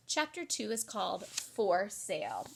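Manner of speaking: ordinary delivery, nothing marked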